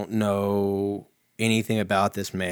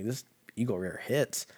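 ordinary delivery

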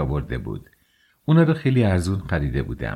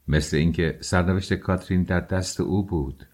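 plain reading voice

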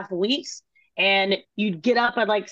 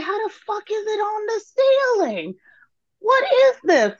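high, incredulous squeal that gets louder at the end; retold disbelief; 12 semitones higher, 4 dB louder than usual. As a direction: relive the shock of spotting fish on the ceiling. Retold discovering fish on ceiling.

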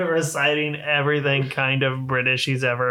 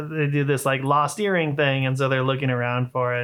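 ordinary talk, nothing marked

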